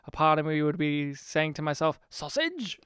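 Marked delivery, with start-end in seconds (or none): 2.1-2.8 high-pitched, soft comic catchphrase; Blackadder impression. Podcaster privately quoting a favorite actor's old comedy line, high comic impression. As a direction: squeal it quietly to yourself. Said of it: "From Blackadder".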